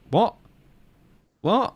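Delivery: a high, incredulous what; sudden high-pitched disbelief; baffled. Real, not acted. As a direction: squeak it out in disbelief. Baffled reaction to quote.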